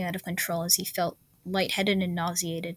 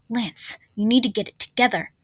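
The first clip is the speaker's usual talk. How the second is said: hissed; 3 semitones higher, 4 dB louder than usual. A narrator's reading. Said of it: "she hissed in his ear".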